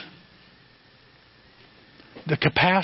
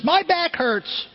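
grandma complaining; 11 semitones higher, much slower than usual. Retold or imitated. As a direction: drag it out like an achy grandma. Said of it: "one of them says".

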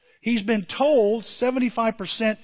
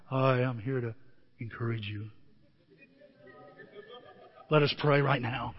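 lower, softer pious pastor voice; lower, softer mock-solemn preacher voice; mock solemn visitor; 7 semitones lower, 6 dB quieter than usual. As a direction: put on a mock holy hush for a sickbed visit.

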